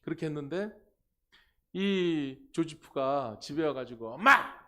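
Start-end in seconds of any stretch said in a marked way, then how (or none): 4.1-4.7 put-on child's loud, high yell for mom; angry call. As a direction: shout for mom like an angry kid. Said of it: "그러면서 화를 낸 거야". (화난 아이가 엄마를 부르는 고함 연기)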